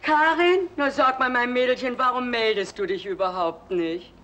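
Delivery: hoch und laut vor Freude; überschwängliche Begrüßung. Acted. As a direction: Karin freudig überrascht anrufen. Mutter begrüßt Karin überschwänglich. (Mutter begrüßt die Tochter am Telefon, hoch und überschwänglich)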